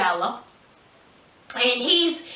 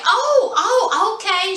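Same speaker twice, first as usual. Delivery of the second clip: surprised laughing; 7 semitones higher, 3 dB louder, slower than usual. Retold or imitated. Chantel reacts to invite.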